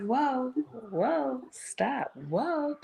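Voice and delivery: high, light calls of whoa to a horse; a playful, high-pitched imitation of reining in; mock horse rider whoa. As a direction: pull up the horse with high whoas. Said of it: "'Cause I was like".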